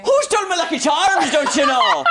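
hammed-up Irish accent. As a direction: ham up a leprechaun Irish brogue. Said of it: "You gotta say it in an Irish accent".

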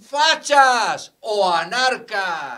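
mock outrage. Mocking what people are called, loud and slow. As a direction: spit out both labels slowly.

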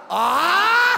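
loud high laughing cry; burst of laughter. Real, not acted. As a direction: crack up mid-take. Laughs after his attempt.

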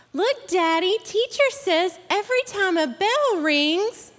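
high, lightly voiced little kid; child's movie line. Host quizzing audience with a movie quote, high child's voice.

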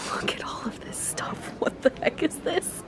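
hushed whisper of disbelief; amazed whisper. Real, not acted. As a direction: whisper in awe at everything you see. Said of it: "me whispering at all this amazing stuff".

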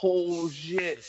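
high, surprised gasp of a curse; shocked oh shit. Retold or imitated. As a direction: blurt it out in shock. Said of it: "I was like".

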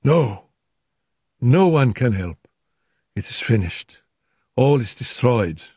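delirious terror. Acted. Feverish wife raving about the devil, anguished. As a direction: rave in fevered dread.

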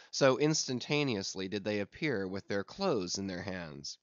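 usual speaking voice

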